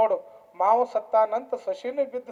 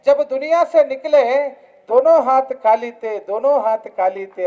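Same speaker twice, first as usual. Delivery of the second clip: slow, projected declaiming of a verse; preaching; 4 semitones higher, 8 dB louder than usual. Real, not acted. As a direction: ring out the sage's saying, drawn out. (ಪ್ರವಚನದಲ್ಲಿ ನಿಧಾನವಾದ, ಗಟ್ಟಿ ದನಿಯ ಘೋಷಣೆ)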